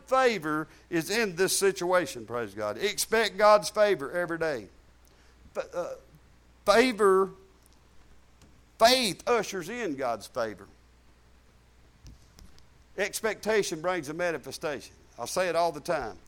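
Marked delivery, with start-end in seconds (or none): none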